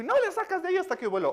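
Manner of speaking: orden aguda y atropellada; orden enojada. Retold or imitated. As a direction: imita a la esposa mandando, rápido y tajante. Esposa enojada da una orden. (mandona, aguda y rápida)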